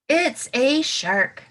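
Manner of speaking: slow, loud, drawn-out reveal; dramatic reveal. Shown as a demonstration. Teacher revealing the shark to her class slowly and loudly. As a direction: unveil it slowly for the kids. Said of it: "dramatic reveal to class".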